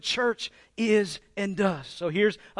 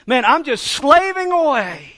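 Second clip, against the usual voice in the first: mock whine; 7 semitones higher, 8 dB louder than usual. Mocking the Pharisee's complaint.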